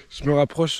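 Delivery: words broken by laughter; laughing. Real, not acted. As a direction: giggle through the phrase. Laughing through the same phrase.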